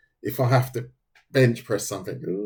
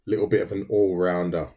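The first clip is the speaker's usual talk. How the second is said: ordinary delivery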